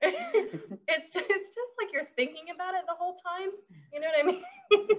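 high, giggling through the words; laughing. Real, not acted. Laughing with the host about the strong flower, high and giggly. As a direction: laugh it out as you talk.

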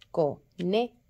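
"conejo" split, co, NE, jo; stress on NE. Shown as a demonstration. Teacher showing where the force is, "conejo" with NE stressed. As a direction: pause between beats of "conejo", hit NE. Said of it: "donde estaba la fuerza de la sílaba".

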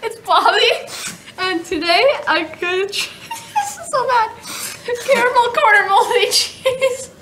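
high, laughing through the whole intro; can't keep a straight face; giggling intro. Real, not acted. Kid introducing a gross combo, giggling throughout.

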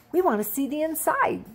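lightly voiced bunny begging; eager bunny. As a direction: ask like an eager small child. Grace begs to come in.

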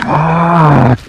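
sharp cry of pain; short loud yelp of hurt. Real, not acted. Hurt in game, lost health.

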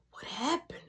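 near-whispered retold question; soft, breath-only question; hushed concern. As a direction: imitate a child asking very softly. Little brother asks, as she cries.

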